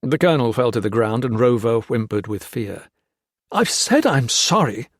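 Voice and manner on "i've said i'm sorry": choked. Acted. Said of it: "Hawkins choked out".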